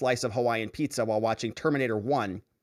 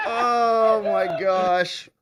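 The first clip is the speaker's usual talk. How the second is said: laughing; 2 semitones higher, much slower than usual. Real, not acted. Recovering from laughing fit.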